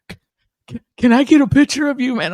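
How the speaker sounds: high, loud stammering fan imitation; shaking with nerves. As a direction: stutter it as a mimicked overwhelmed fan. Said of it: "he's, like, shaking".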